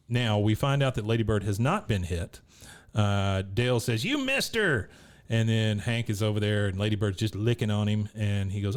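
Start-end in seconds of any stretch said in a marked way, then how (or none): 4.0-4.9 high-pitched exasperated accusation; mock outraged cry. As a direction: imitate an indignant squawk at a missed shot. Dale upset at missed shot.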